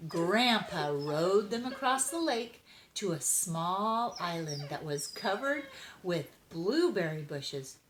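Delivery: ordinary delivery, nothing marked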